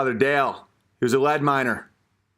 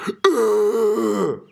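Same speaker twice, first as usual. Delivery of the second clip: groan of disgust; 5 semitones higher, 3 dB louder than usual. Real, not acted.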